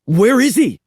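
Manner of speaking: high, loud frightened question; panicky. Acted. The boys searching for Chet, panicky high voice. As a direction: sound scared for your friend. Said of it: "their panicky voices".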